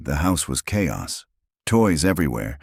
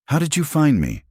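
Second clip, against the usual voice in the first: angry; 12 semitones higher, 3 dB louder than usual. Acted. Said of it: "Her face went from surprise to anger".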